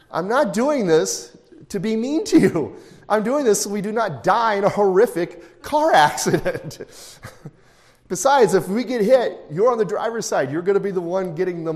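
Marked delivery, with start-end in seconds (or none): 0.1-9.8 exasperated dad. Retold or imitated. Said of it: "It's like".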